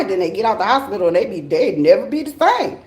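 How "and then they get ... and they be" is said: ordinary talk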